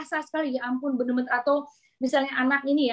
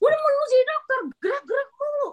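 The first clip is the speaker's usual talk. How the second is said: much higher, mock scolding tease; teasing colleague; 14 semitones higher than usual. Colleague teasing the fidgety doctor, mock scolding. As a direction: tease her like a nosy colleague.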